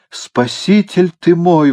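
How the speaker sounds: high, drawn-out effusive cry; exclaiming. A narrator's reading. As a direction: hail your rescuer in drunken rapture. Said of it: "воскликнул он". (восторженное протяжное восклицание)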